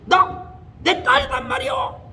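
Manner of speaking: high, strange voice from the possessed woman; eerie high cry claiming to be the daughter; demon voice. Retold or imitated. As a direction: let the spirit speak through her. Demon answers through the woman.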